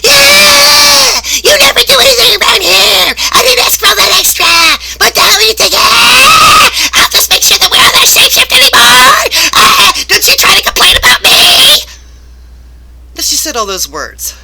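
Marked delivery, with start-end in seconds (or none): 0.0-11.9 shrieking hysterical screaming, much higher and louder; hysterical boss. Retold or imitated. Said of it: "speaks to me in a very hysterical manner".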